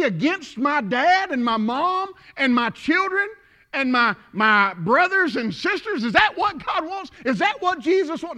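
high-pitched rhetorical questioning; incredulous preaching. Acted. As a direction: press the congregation with disbelieving questions.

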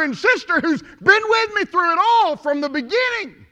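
high, loud, building sermon cadence; preaching at full force. Acted. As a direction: build each repetition to a ringing climax. Preaches to a climax.

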